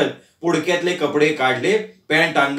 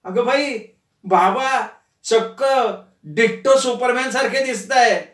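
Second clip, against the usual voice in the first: daughter exclaims at her father's costume, excited; excited cry; 6 semitones higher than usual. Acted. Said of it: "Mithali सुद्धा ओरडली".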